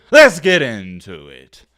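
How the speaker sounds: low, slow, oddly dragged out; weird low delivery. Real, not acted. Vlogger starts the video and botches the line, low and slow. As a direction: drop it low and drag it slowly. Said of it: "Why did I say it so weird?".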